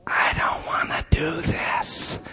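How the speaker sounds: put-on hushed, slow little boy whisper; scared child whisper. Seven-year-old son whispering to his father, scared and hushed.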